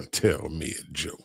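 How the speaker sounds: a mocking laugh through the line. Real, not acted. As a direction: chuckle the words out. Laughing repeat of viewer request.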